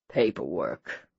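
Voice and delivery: low, slow groan of a husband. A narrator's reading. Husband groans at the paperwork, low and slow. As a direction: drag the word out with a low groan. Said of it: "he groaned".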